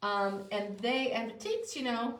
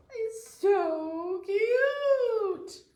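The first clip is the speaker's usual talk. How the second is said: high, drawn-out squeal of delight; gushing squeal; 11 semitones higher, 7 dB louder, much slower than usual. Real, not acted. Gushing over adorable donkey card.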